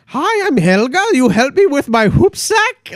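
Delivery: high, slow put-on character voice; hammed-up cheery imitation of a foreign traveler; Jamie Lee Curtis as Helga. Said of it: "Jamie Lee Curtis comes in".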